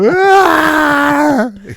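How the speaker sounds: helpless high laugh at the tampon ad; loud high laugh. Real, not acted. Tampon ad made him cry.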